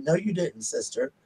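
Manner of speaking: soft, sassy dog comeback; quietly put-out "sister"; mock sass. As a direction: voice an affronted dog under your breath. Voicing the dog's offended look.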